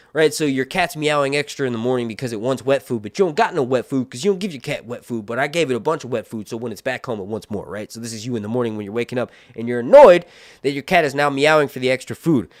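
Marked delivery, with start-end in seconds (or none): none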